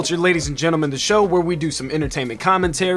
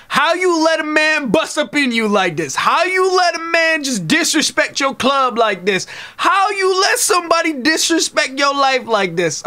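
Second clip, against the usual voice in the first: scolding; 8 semitones higher than usual. Acted. Scolding Mama Tay directly.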